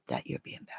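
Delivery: low whispered scolding. Real, not acted. Owner scolding the dog, low whisper. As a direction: whisper the threat to the pet.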